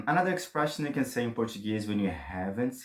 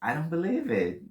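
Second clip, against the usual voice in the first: mock disbelief; 5 semitones higher than usual. Shown as a demonstration. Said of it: "It's like".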